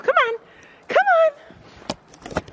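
high sing-song coax. Real, not acted. Vlogger coaxes an animal to come closer.

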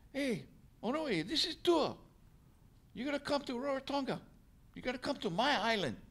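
very high-pitched imitation of a crewmate calling over the radio. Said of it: "the radio crackles".

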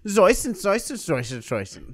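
mock German through the teeth; fake German. Comedian mocking German as made-up syllables, fake German. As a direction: repeat nonsense German syllables, slowing as they drop. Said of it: "whispering through your teeth and using as many syllables".

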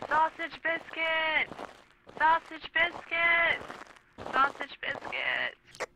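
high, drawn-out mock kid's scream; screaming little kid. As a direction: bawl it slowly like a child, over and over. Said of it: "there was a little kid at the freezer section screaming".

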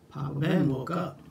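plain reading voice

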